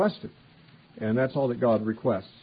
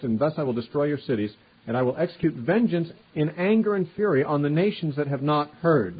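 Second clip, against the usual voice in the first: scripture reading; 3 semitones higher, 3 dB louder than usual. Acted. Preacher reading God's judgment on idolaters, loud and declaiming. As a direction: proclaim it from the pulpit.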